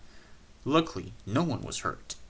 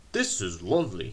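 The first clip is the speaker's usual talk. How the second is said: put-on content old engine; 4 semitones lower than usual. A narrator's reading. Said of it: "he thought".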